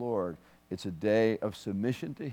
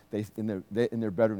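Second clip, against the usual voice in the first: usual speaking voice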